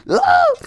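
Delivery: very high, loud squeal; short piercing shriek of triumph; excited shriek. Real, not acted. Succeeds after threatening to cry.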